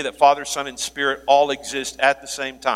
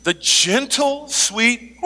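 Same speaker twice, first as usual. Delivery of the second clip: mock sweet; 7 semitones higher, much slower than usual.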